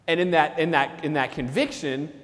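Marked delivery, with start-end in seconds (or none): none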